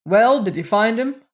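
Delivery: loud, impatient demand. A narrator's reading. Magnate wheeling on returning doorman, demanding. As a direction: pounce on him with the question.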